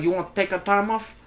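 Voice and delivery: put-on movie gangster putting on an accent; Scarface. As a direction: threaten like a comic drug lord. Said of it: "he's just sitting there like Scarface".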